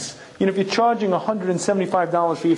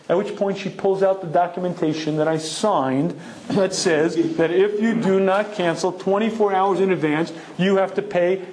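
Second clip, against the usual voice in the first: ordinary delivery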